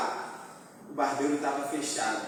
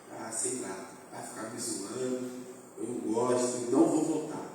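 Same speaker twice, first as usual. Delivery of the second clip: sad quiet prayer; 5 semitones lower, 7 dB quieter than usual. Retold or imitated. Praying sadly in his room.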